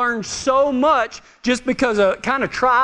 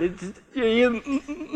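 halting, quieter mock stutter; 6 dB quieter, much slower than usual. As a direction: get stuck on the word, imitating a stutterer. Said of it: "Moses stuttered".

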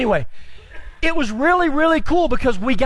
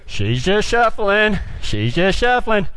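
slow, lower mock imitation; mocking parroting; 4 semitones lower, slower than usual. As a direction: parrot the criticism slowly and low. Parroting the instructor's criticism.

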